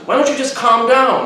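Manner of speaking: high, slow mock scolding; confrontational girl. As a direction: imitate her telling you off. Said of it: "she's being confrontational, and she says".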